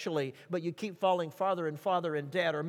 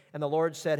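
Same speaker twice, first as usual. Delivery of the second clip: usual speaking voice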